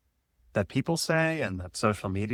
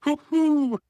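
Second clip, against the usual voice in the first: owl hoot; 5 dB louder than usual. Pretend. Owl hoot, high and loud.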